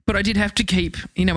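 normal talking voice

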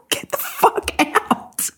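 high, slow strained screech; retold furious outburst. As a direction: drag out a furious order to leave. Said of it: "So I am furious".

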